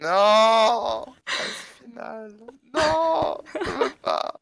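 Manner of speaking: high, fake-crying protest; mock wailing. Refusing the translated title, hammed-up fake sobbing. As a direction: whine like a wounded child, hammed up.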